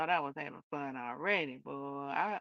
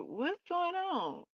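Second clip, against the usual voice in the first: high, slow baffled question; confused surprise; 13 semitones higher, slower than usual. Retold or imitated. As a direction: squeak it out in disbelief. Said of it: "that's why I'm like".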